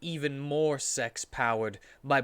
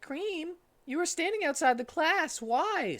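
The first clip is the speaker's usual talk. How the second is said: high boyish cartoon voice; squeaky young fox asking; Tails voice; 14 semitones higher than usual. Tails asking Cream why she stood outside, high voice.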